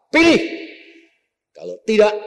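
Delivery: loud, high command; the teacher barking an ultimatum at his pupil; threatening bark. Acted. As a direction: snap the order like a final warning.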